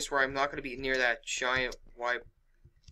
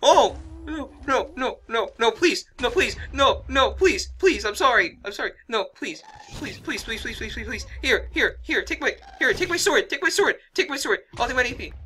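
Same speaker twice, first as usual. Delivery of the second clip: high, loud desperate pleading; panicked begging; 10 semitones higher, 7 dB louder than usual. Real, not acted. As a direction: beg for your life, screaming. Begging while being attacked.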